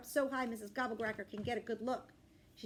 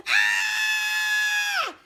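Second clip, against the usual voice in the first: put-on high piercing child scream; 17 dB louder than usual. A narrator's reading. Child screaming as dad drags him, high scream. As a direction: scream in terror. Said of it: "my screams".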